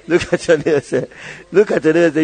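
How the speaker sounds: ordinary talk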